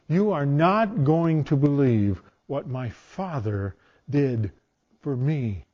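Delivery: slow, earnest, every word weighed; slow down and mean it as your own testimony; slow heartfelt testimony. Acted. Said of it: "can say the exact same thing".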